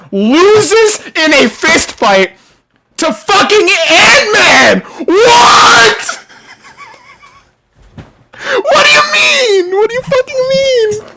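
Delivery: rising to a high furious shout; high-pitched disbelieving screech; outraged yelling. Real, not acted. Outrage at Kang losing to Ant-Man.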